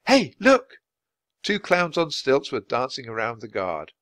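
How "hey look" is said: put-on high, loud girlish cry; excited interruption. A narrator's reading. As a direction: break in loudly and high. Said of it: "Annie broke in".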